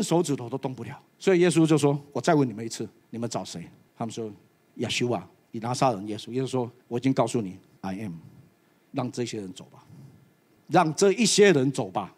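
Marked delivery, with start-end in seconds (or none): 2.1-9.8 Jesus speaking low and quiet; a low calm repeat of the question; soft and low. Acted. Jesus asks again softly. (低声平静地问)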